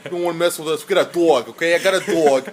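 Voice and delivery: loud, hammed-up New Yorker; New York accent. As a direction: act the mouthy New Yorker, accent laid on thick. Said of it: "You gotta do it for New York".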